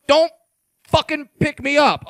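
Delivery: normal talking voice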